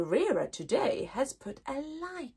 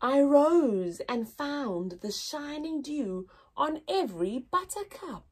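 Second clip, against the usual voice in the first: put-on poem reading to children. Teacher reading the poem aloud to her class. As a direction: read it gently with long pauses.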